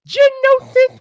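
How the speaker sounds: the name flies up into a squeak; high pitched. Real, not acted. Host greeting his enforcer in chat, an accidental high squeak. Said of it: "Damn, that was high pitched".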